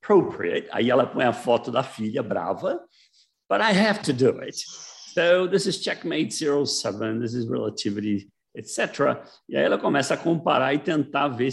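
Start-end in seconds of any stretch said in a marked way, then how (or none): 0.0-0.7 lightly voiced Australian lecturer; Australian accent. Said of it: "aquele sotaque australiano". 3.5-9.2 a hint of an Australian accent. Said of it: "aquele sotaque australiano".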